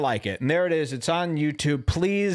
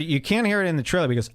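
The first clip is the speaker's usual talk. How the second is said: ordinary talk, nothing marked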